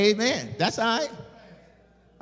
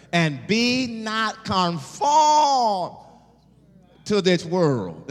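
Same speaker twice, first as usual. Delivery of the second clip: drawn out, slow and louder; slow emphatic scripture; 5 semitones higher, 4 dB louder, much slower than usual. Acted. Preacher stretching the verse to his congregation, slow and loud.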